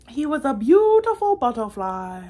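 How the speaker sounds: the big storybook reveal, delighted; grand reveal. Acted. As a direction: make it a triumphant finish. The butterfly reveal at the end.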